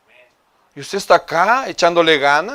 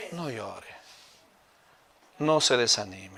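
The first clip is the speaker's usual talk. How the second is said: soft, low, soothing preaching; tender consolation; 4 semitones lower, 6 dB quieter than usual. Real, not acted. Preacher telling a lonely congregation not to cry, tender consolation. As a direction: console them gently, dropping the voice.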